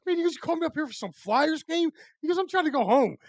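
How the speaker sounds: high, put-upon whine; buddy dragged upstairs for nothing, whining high; annoyed buddy. Retold or imitated. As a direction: whine it like a fed-up friend.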